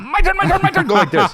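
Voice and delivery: very high, comic clamoring; clamor for a turn in a squeal; hammed-up eagerness. Co-host jokingly demands a turn, in a high eager squeal. Clowning a pushy interruption.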